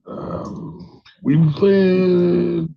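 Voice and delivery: loud caller. Real, not acted. Caller shouting over noise.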